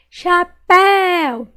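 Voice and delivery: "Chapéu" loud, slow, high long PÉU; stretched stressed syllable. Shown as a demonstration. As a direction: call out "Chapéu", holding PÉU. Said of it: "Qual que eu demorei mais falar".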